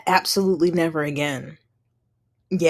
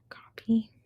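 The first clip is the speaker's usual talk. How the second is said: whisper; 3 semitones higher, 3 dB quieter than usual. Real, not acted. Whispering aside while posting.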